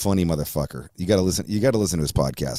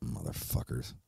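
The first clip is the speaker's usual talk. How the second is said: breathed under the breath, bitter; muttered curse; 5 semitones lower, 14 dB quieter than usual. Real, not acted. Host cursing tyrants to himself, in a soft breathy mutter. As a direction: spit it out almost silently.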